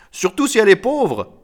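high, loud guest's quip; mocking quip. A narrator's reading. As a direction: toss in the joke brightly. Guest adds mocking witticism. (boutade lancée d'une voix haute)

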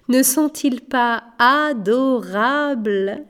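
slow, drawn-out amused gushing; amused princess. A narrator's reading. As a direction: coo it slowly as an amused princess. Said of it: "rit la princesse". (princesse amusée, mots étirés)

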